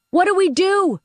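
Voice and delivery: high, frantic plea; panicked question. Acted. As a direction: beg for a plan. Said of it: "Kira asked".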